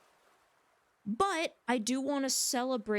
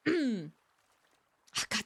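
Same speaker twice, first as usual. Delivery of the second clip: ordinary talk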